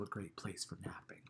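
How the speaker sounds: hushed whisper so the ghost can nap. Acted. Narrator not waking the napping ghost, whispering. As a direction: breathe it out softly, not to wake anyone.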